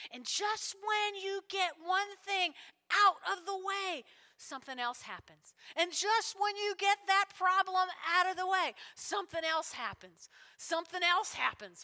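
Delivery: rising preacher's cadence; preaching. Acted. Preacher warning the congregation, building emphasis. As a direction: press the point like a pastor.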